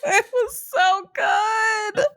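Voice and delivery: high, drawn-out fangirl whine; real high wail of delight; overwhelmed gushing. Real, not acted. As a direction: squeal it slowly, nearly crying with delight. Gushing over the book's ending.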